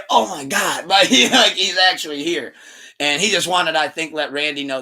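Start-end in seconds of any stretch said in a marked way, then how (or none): 0.1-0.8 mock shock. Backstage shock, following whisper.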